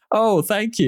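high-pitched, put-on thank you; humouring a child's gift; polite mock gratitude. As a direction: act pleased with a useless present. Queen acted receiving a silly gift.

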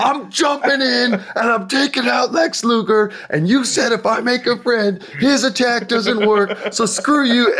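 high-pitched, excited mock crowing; triumphant outburst. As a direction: blurt it out high, gloating over the betrayal. Triumphant outburst retold from the game.

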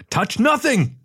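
high-pitched, snarled warning; aggressive snarl. A narrator's reading. Engineer stopping the chief touching his work, aggressive snarl. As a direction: warn him off sharply, pitch leaping up. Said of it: "snarled Elrod in a voice so aggressive".

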